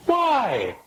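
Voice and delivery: high, falling "why"; incredulous question. Acted. Friend questions a man's party loyalty in a joke, incredulous.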